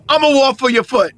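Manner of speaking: loud, high mock menace; acted threat. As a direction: deliver the hammed-up final threat. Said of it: "One of them was like".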